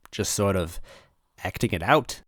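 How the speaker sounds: plain reading voice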